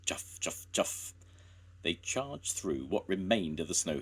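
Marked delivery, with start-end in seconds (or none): 0.1-1.1 breathy steam chuffs; engine chuffing. A narrator's reading. Ivor charging through snow, breathy chuffing.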